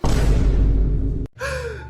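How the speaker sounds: sharp unvoiced gasp; shocked gasp. Mother gasping at the shocking news.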